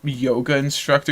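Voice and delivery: usual speaking voice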